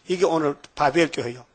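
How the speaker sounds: ordinary talk, nothing marked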